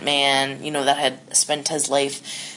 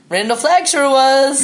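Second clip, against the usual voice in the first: high, slow mock villain; creepy villain voice; 10 semitones higher, 7 dB louder than usual.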